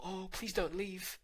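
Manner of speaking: mock a needy guy pleading on the phone; pleading boyfriend. Needy guy begs his girlfriend not to leave, high and pleading. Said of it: "he'll on the call".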